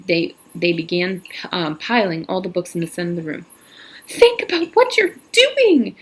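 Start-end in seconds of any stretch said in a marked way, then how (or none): 4.1-6.0 high, loud, slow pleading cry; desperate cry. Acted. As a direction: beg the mob to stop. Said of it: "cried Miss Catherine".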